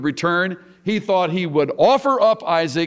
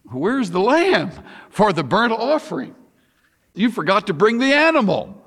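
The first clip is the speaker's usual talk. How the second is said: put-on high-pitched puzzled boy; Isaac; 7 semitones higher than usual. Son asks father about the missing sacrifice, high boyish questioning. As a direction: play a boy questioning his dad, voice swooping high. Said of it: "Isaac spoke to his father and said".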